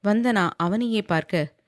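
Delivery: plain reading voice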